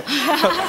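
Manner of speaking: high chuckle; laugh. Acted. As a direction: break into a high-pitched laugh. Laughing at the word đào. (cười giọng cao)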